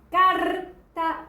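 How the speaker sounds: slow "carta" with a long, strong "car"; stress on first syllable. Shown as a demonstration. Teacher showing mayor intensidad, "carta" with drawn-out "car". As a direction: stretch "car" in "carta", then let "ta" fall. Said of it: "la que suena con mayor intensidad".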